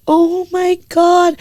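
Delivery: high, drawn-out imitation of adoring fans; gushing strangers. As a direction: stretch it out in a high mock swoon. Strangers gushing over the dog.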